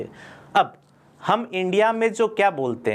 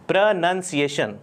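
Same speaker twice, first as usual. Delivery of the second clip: ordinary talk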